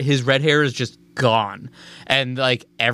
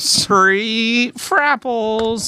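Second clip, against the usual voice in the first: cartoon voice; 10 semitones higher, much slower than usual. Absurd cartoon product name.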